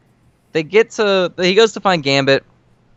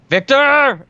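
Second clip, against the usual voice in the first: a sharp called name, pitched up; retold shout; 11 semitones higher, 4 dB louder than usual. Retelling Wolverine spotting his brother, a mock shout. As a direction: call out to the enemy by name.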